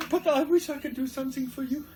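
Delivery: small dog sorry to its injured master, high voice; little dog's voice. Retold or imitated. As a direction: squeak out your apology to your master. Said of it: "the doggy says".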